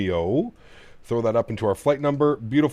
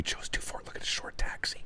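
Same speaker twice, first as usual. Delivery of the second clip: voiceless whisper of the runway; hushed cockpit aside; 10 dB quieter than usual. Real, not acted. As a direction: whisper it so no one hears. Suddenly whispering cockpit notes.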